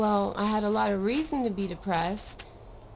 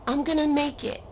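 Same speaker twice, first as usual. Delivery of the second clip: a high, louder vow; determined; 6 semitones higher, 4 dB louder than usual. Pretend. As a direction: cry it out like a promise. Voicing determination to viewers.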